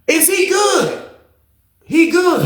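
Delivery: high, slow shouted concern; booth lady. Retold or imitated. Booth lady calling out about the fallen inmate, high and slow. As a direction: call out like a worried guard lady. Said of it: "The lady in the booth".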